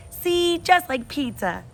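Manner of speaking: put-on high, slow toddler sing-song; proud toddler. Toddler Fudge showing off on the bike, slow and high. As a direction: savour it like a proud little boy.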